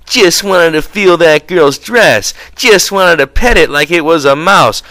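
mock them like a little girl copying another; mocking mimicry. A narrator's reading. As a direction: elaborate high-pitched taunting imitation. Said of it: "elaborate manner of little girls when they are mimicking".

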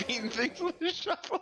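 high voice, squeezed by laughter; amused, high-pitched laughing remark; real laughing. Real, not acted. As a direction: say it while cracking up. Laughing at teammate with shovel.

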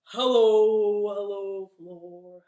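hellos sinking low toward the floor. Shown as a demonstration. Hello to the floor, as low as possible. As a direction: drop your voice down low. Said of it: "say hello as low as we can".

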